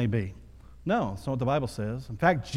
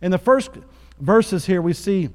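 louder and higher sermon drive; preaching at full force; 4 semitones higher, 4 dB louder than usual. Acted. Pastor urging the church to follow Christ, fervent preaching. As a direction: preach it, lift the room.

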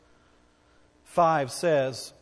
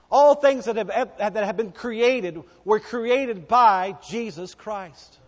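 raised, emphatic preacher's voice; forceful preaching; 3 semitones higher, 5 dB louder than usual. Real, not acted. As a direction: drive the point home, loud and insistent. Preaches insistently at full force.

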